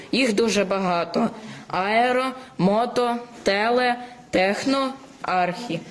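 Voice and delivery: normal talking voice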